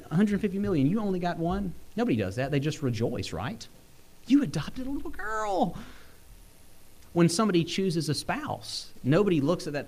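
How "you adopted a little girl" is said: much higher, delighted congratulation; a neighbour cheering the adoption, bright and delighted; mock delighted. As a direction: gush like a happy well-wisher. Imagined people rejoicing at adoption.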